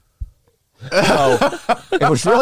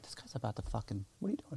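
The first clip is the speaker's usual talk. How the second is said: hushed alarm; 19 dB quieter, faster than usual. Retold or imitated. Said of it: "She's like".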